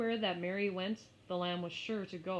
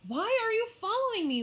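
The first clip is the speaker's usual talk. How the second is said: exasperated; 9 dB louder than usual. Acted. Mary exasperated at lamb.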